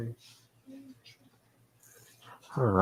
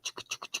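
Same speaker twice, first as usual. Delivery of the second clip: brushing noise; 20 dB quieter, faster than usual. Retold or imitated. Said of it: "and you're like".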